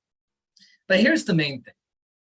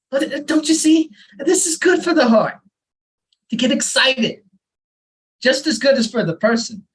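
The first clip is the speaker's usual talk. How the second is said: higher, louder and eager; a father lecturing eagerly, much higher and louder; excited explaining; 8 semitones higher, 4 dB louder than usual. Acted. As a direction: push the point with rising enthusiasm. Father excitedly explains the heart's workout.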